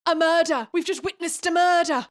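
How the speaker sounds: loud, high shocked cry of alarm; shocked shout. Acted. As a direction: yell it like you've just seen a killing. Characters witness a neck snapping.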